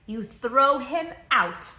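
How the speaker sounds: slow, firm, emphatic; firm answer. A narrator's reading. Reader telling the children firmly, emphatic. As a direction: lay down the law.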